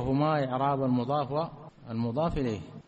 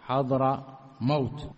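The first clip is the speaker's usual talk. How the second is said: slow demo; 2 semitones lower, slower than usual. Shown as a demonstration. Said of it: "فنجعلهما كلمة واحدة".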